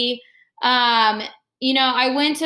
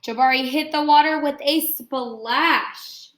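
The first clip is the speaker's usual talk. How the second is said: expressive picture-book read-aloud; reading to children; 2 semitones higher than usual. Acted. Teacher reading Jabari Jumps to her class, expressive read-aloud. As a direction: read the story warmly to the class, pausing on each step.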